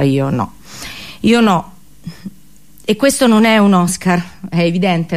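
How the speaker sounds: ordinary talk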